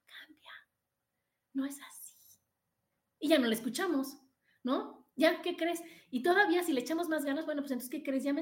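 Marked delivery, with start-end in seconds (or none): 0.0-2.2 barely audible voiceless whisper; a fading inner voice, almost gone; faint faraway whisper. Retold or imitated. The inner voice fading into the distance, barely a whisper. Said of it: "se va haciendo para allá".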